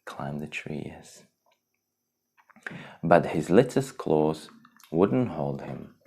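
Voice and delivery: ordinary delivery, nothing marked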